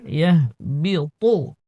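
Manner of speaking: ইয়াহবিতু with the sound made thick; heavy ra. Shown as a demonstration. Teacher showing a heavy pronunciation. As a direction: read it heavy. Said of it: "পর উচ্চারণ মোটা".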